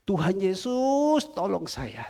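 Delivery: long-drawn "Yesus", then falling away; pleading prayer. Retold or imitated. Praying for help during therapy, pleading. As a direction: call on Jesus slowly. Acted prayer crying to Jesus.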